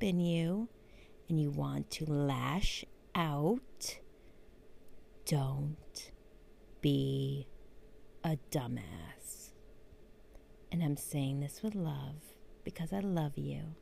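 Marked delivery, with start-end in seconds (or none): 1.3-9.5 whisper. Acted. Repeats the whispered advice.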